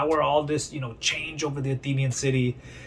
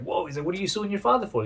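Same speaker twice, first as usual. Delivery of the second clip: high, quick shocked question; surprised; 9 semitones higher, faster than usual. Retold or imitated. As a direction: react with shock, then ask fast. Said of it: "Socrates is like".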